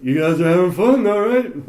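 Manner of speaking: high, loud California oddball voice; Crazy Lance. Retold or imitated. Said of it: "he's like".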